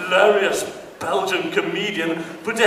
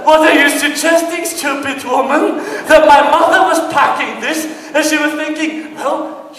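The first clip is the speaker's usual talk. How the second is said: loud, high, furious rant; angry retort; 10 semitones higher, 7 dB louder than usual. Retold or imitated. Comedian yelling back at the airline clerk, loud. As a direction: tear into her at full volume. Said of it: "angry retort".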